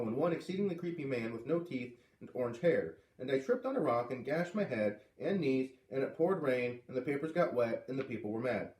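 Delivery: ordinary delivery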